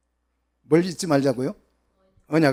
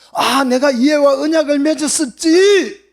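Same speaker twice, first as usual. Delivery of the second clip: high, loud sudden recollection; God's exclaiming voice; 10 semitones higher, 11 dB louder than usual. Acted. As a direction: cry it out as God suddenly remembering. God remembering covenant, voiced. (하나님이 떠올리듯 높고 크게)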